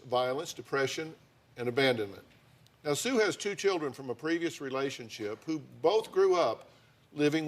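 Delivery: brisk documentary-style voiceover; TV voiceover narration. Acted. Host narrating the couple's background for viewers, brisk voiceover. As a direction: set up the story for viewers.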